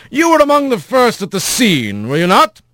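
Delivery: pressing a witness politely; lawyer voice. A narrator's reading. Lawyer cross-examines.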